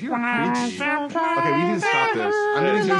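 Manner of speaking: squeaky, chipmunk-high voice; helium voice. Real, not acted. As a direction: speak like you inhaled helium. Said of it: "you're all talking like chipmunks".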